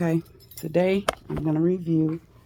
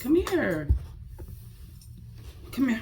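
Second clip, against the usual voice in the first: coaxing; 6 semitones higher than usual. Real, not acted. Coaxing the dog.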